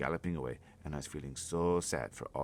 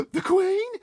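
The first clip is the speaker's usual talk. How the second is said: aghast; 17 dB louder than usual. Acted. Sophie horrified the giant means the Queen, gasping high and loud. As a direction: gasp then a very high, loud question.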